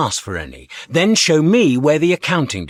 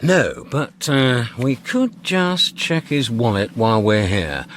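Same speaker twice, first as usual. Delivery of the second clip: ordinary talk, nothing marked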